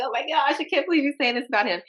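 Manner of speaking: high, quick mock disbelief; hammed-up scandalized reaction; mock shock. Acted inner shock at voter's remark.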